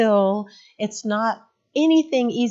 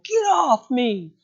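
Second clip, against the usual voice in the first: slow, hammed-up angry shout; belligerent, loud; 8 semitones higher, 8 dB louder, slower than usual. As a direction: imitate a stressed kid lashing out. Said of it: "belligerent, loud, exhausted, stressed at school".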